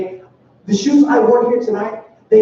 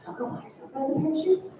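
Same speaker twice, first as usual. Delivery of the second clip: higher, far-off mock granny voice; grandma impression; 5 semitones higher, 13 dB quieter, faster than usual. Grandson imitates grandmother telling him to buy shoes, mock granny. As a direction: squeak it like an old grandma. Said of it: "it'll sound more like".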